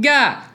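sudden loud high "Gah!" of exasperation; frustrated shout. Retold or imitated. Venting about being stuck in hard times, loud high exasperated yell. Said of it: "that was probably loud".

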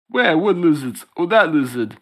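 high, loud clueless cop. Acted. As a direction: play a dim partner cop, squeaking. Second cop answering partner.

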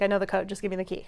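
ordinary talk, nothing marked

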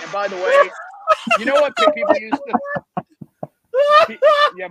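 loud, very high laughing speech; cracking up. Real, not acted. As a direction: try to talk through real laughter. Cracking up while trying to continue.

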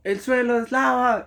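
high, drawn-out imitation of a yell; mock shout. Friend startling the whole mall, drawn-out mock shout. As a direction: call it out high and slowly, as a retold shout. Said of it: "Yo voy a gritar".

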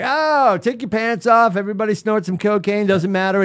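high-pitched, anything-goes mock cry; mock careless abandon. Gamer mocking Madden without competition, high careless mock voice. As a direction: throw it out like a company gone wild.

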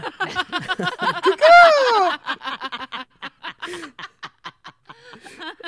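loud, high gobbling cry with laughter; mock turkey gobble. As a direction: shriek out a badly faked turkey call. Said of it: "what you think a turkey sounds like".

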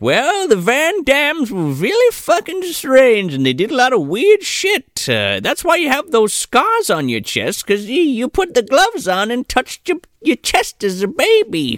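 high granny explaining; lightly voiced old lady. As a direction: explain like a dotty old woman. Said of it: "And she's like".

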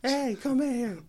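high-pitched call across the house; calling someone over. Acted. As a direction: holler for someone in another room. Acts out yelling off camera.

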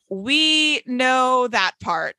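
very slow, drawn-out reply; slow mock-patient answer; deadpan retort. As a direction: stretch it out as a retold dry comeback. Retold dry reply to obvious news.

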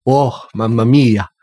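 slow lightly hammed-up Italian; Italian accent. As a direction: savor it like an Italian. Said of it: "Italian exclamation".

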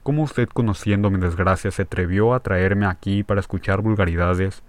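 ordinary delivery, nothing marked

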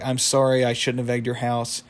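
normal talking voice

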